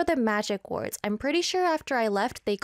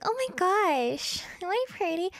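cute voice; 8 semitones higher than usual. Shown as a demonstration. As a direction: put on a cutesy high voice. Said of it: "I speak in a more cute voice".

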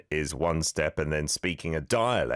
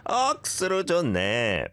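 higher pitch throughout, long 네; 12 semitones higher, much faster than usual. Shown as a demonstration. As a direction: lift the phrase high through 네. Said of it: "Go up at the end".

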